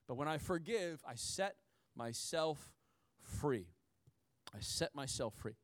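tender earnest close; quiet conviction. Real, not acted. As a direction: drop to a near hush on free. Closing point softly.

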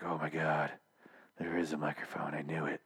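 low, near-whispered mock discovery; hushed. Reviewer voicing paranoid man finding a microphone, hushed. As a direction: say it under your breath, as if overheard. Kai finds a hidden microphone.

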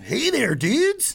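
high, exaggerated hip pitchman; hammed-up cool dude greeting; cooler young voice. Shown as a demonstration. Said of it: "a much cooler voice".

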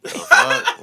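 high squeak broken by laughter; laughing. Real, not acted. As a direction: squeal it while cracking up. Laughing at friend-zone joke.

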